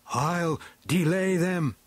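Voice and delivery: high, slow, determined call; crying out. A narrator's reading. Beastman offering to hold off the riders, crying out. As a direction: call it out high, stretching each word. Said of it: "he cried".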